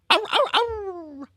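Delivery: high, slow whimpering ow ow ow; mock pained squeal. Streamer yelps after hurting himself, mock pained squeal. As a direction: yelp like it really hurt, hammed up.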